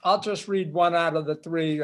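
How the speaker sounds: ordinary talk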